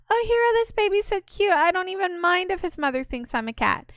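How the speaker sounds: put-on very high, squealing girlish voice; squealing cat girl. Cat girl gushing to Hero about the cute baby, very high squeal. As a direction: gush over the baby like an excited cat girl.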